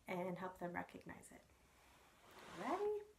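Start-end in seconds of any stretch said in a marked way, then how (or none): 2.6-3.0 playful cue. Real, not acted. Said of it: "playful cue to baby".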